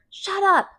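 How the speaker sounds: high, hushed command; whisper. A narrator's reading. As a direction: whisper it sharply to a little brother. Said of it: "I whispered".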